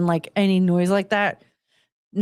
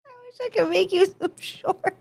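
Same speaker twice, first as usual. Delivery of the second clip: laughing; 13 semitones higher, 4 dB quieter than usual. Real, not acted. Laughing hard at a joke.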